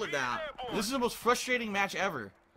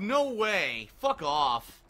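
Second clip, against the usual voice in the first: ordinary delivery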